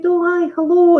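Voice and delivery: high, startled little hello; flustered hello. Retold or imitated. To a fan who spotted her in Asda, a high flustered hello. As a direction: caught off guard, squeak out a greeting.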